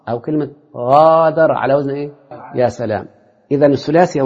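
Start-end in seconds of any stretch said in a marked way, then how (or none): 0.8-1.5 غادر with a long held aa after غ; stretched long vowel. Shown as a demonstration. Teacher adding an example for students, غادر with its first vowel held long. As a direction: draw out the alif in غادر.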